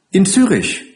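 put-on high, tearful child voice; a small high answer through mild sobs; sobbing child. Crying child tells where mother lives, high and sobbing. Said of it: "Das Kind schluchzte".

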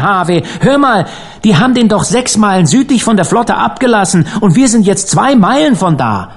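high, emphatic, agitated argument; incredulous protest. A narrator's reading. Dan insisting to Harvey the corpse came deliberately, incredulous protest. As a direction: scoff in disbelief, pitch staying high. Dan rejects the current explanation.

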